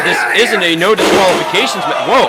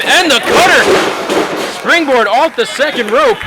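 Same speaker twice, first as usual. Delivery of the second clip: excited call; 8 semitones higher, slower than usual. Real, not acted. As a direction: call the big move with a jolt. Big move called in commentary.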